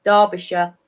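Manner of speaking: Derbyshire as DAH-bih-shuh, pitched low; British pronunciation. Shown as a demonstration. Guest answering how she says it, Derbyshire as DAH-bi-shuh. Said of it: "How do you say it?".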